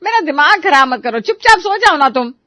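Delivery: loud, high-pitched telling-off; exasperated anger. Acted. Wife tells husband to stop and sleep, exasperated. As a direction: cut him short, at the end of patience.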